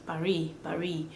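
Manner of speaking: "Paris" said as "pa-ree"; French silent S. Shown as a demonstration. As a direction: leave the S off "Paris". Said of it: "completely silent".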